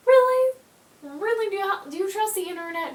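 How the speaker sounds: high, loud disbelieving "really"; incredulous. Real, not acted. Complaining about DVDs disappearing, high incredulous scoff.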